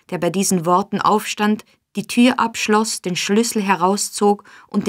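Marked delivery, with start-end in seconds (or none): none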